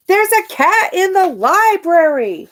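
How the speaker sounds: high, loud shout. A narrator's reading. A child at story hour shouting about the cat, high and loud. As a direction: call out in alarm. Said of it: "someone shouted".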